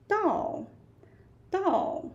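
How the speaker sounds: "到" starting high and dropping sharply; falling tone. Shown as a demonstration. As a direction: let the pitch fall hard. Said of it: "falling tone".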